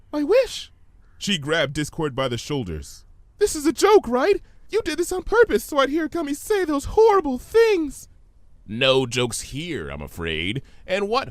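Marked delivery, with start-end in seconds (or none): 0.1-0.7 high alarmed cry; startled cry. Acted. Sudden realisation. 3.4-8.1 high, loud frantic accusation; panicked pleading. Acted.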